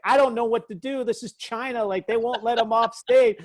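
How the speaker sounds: high, panicked pleading; panicked self. Retold or imitated. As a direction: mimic your own helpless panic, pitched high. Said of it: "I was like".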